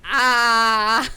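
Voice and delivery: loud, drawn-out cry of indecision; loud wail when put on the spot. Real, not acted. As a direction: let out a big stalling "aah". Player stuck on her turn.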